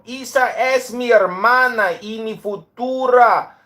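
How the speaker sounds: high, loud, hammed-up Spanish; mock Spanish warning. Fiancé warns Pepe off his future wife, hammed-up Spanish.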